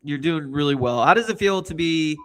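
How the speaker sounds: normal talking voice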